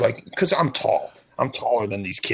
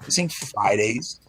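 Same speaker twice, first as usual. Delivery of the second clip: ordinary talk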